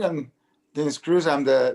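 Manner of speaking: ordinary talk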